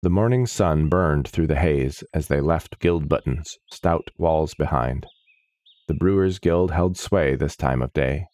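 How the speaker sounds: ordinary delivery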